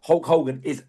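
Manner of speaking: ordinary talk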